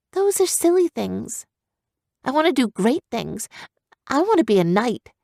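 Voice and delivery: put-on high, eager girl's voice; young girl set on greatness; determined young girl. Girl character voice.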